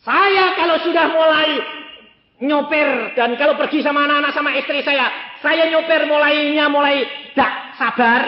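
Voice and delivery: high, loud testimony; loud preaching. Acted. Preacher confessing his impatience at the wheel, loud and high. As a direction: drive the story home from the pulpit.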